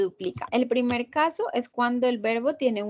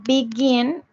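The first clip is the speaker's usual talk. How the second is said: "begin" said louder as be-GIN; final-syllable stress; 5 dB louder than usual. Shown as a demonstration. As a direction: punch the second syllable of "begin". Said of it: "la acentuación esté al final".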